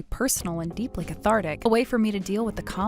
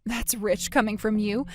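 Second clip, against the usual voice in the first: usual speaking voice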